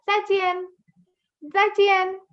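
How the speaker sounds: "Zai chien" high and short; short falling tones. Shown as a demonstration. Teacher repeats goodbye, short high "Zai chien". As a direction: say "Zai chien" as two sudden syllables. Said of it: "both falling short and sudden".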